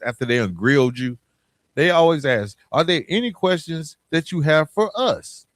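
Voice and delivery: ordinary talk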